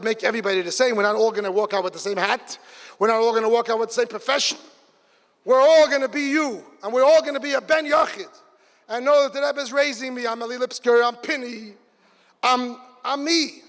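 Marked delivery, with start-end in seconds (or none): 5.4-8.3 rousing preaching. Acted. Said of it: "preaching climax".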